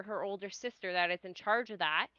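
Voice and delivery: normal talking voice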